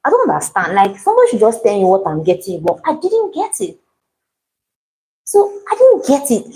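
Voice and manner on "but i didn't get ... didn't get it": pitched way up in exasperation; exasperated. Real, not acted. Baker venting to viewers, high-pitched exasperation. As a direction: voice jumping high as she vents.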